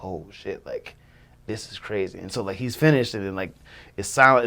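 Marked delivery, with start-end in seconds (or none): 0.0-2.2 hushed, low to yourself; thinking it privately, well under normal volume; quiet stunned disbelief; awed inner thought. Retold or imitated. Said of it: "in my head, I'm just like".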